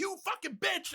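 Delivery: squeezed-up mock rage of a killer doll; mock going nuts. Doll turning on the mom, imitated crazed outburst. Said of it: "just go nuts".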